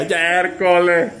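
long, stretched cry of amazement; drawn-out exclamation. Real, not acted. Host reacts to the cup race, drawn-out exclamation. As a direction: stretch the word right out.

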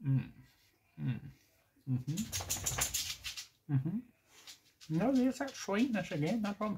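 chewing while talking; finishing a bite; mouth partly full. Real, not acted. Seen 0: Bite at -7; jaw still chewing, lips pressed while speaking.

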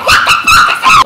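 fast, high, laughing through words; laughing disbelief. Real, not acted. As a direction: laugh while yelling in shock. Reacts to shield being eaten.

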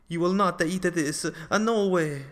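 loud, high-pitched refusal; defiant shout. Acted. Small sculptor facing down the giant, loud defiant shout. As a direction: stand up to the giant at full volume.

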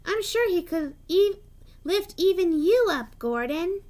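bright eager little engine; excited. A narrator's reading. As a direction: egg Gordon on with glee. Thomas teasing Gordon excitedly.

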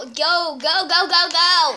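loud, rising chant of urging; urgent shouting. Pretend. Urging the other player on.

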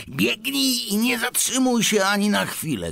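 high, pressing command; urgent urging. A narrator's reading. Shopkeeper sends the boy racing home, urgent urging.

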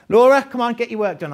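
a teacher calling a fidgeting pupil to get on with her work, loud and firm. Acted. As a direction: snap her back to work across the classroom.

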